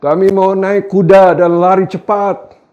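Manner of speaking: high, loud, insistent protest; defiant people's voice. Acted. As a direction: play the stubborn people refusing. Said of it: "kamu berkata".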